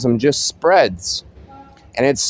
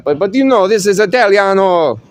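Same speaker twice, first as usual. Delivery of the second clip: put-on Italian accent; 6 semitones higher, 3 dB louder than usual. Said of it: "They, they talk like that".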